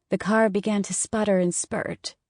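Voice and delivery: usual speaking voice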